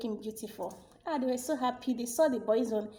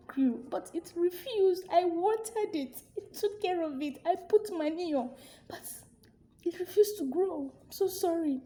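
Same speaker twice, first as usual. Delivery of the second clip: put-on frightened boy pleading; 7 semitones higher than usual. Boy pleading before the king.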